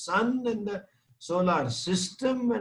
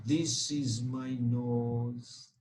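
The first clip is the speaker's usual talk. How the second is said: put-on lower, softer, slow little-child reply; baby answering; 8 semitones lower, 7 dB quieter, much slower than usual.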